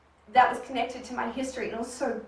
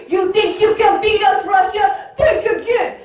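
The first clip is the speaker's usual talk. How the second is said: loud, higher cocky hacker taunt; brash hammed-up hacker threat; taunting movie hacker; 8 semitones higher, 9 dB louder, slower than usual. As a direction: gloat at the Russians like a film hacker.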